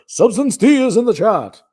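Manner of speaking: high, loud, booming announcer impression; loud, high showbiz announcer voice; Gary Owens voice. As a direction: boom it out like a classic TV announcer. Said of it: "get in my Gary Owens voice".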